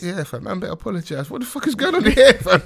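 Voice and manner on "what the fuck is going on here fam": laughing mock outrage; high laughing disbelief. Real, not acted.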